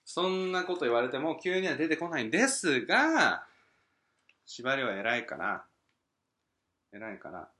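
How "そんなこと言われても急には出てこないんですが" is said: 大げさな困惑. Real, not acted. 無茶振りに大げさに困る.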